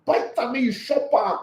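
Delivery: lightly voiced mother giving firm advice; mother coaching her child to fight back, mild mock sternness; imitating mom. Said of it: "My mom said".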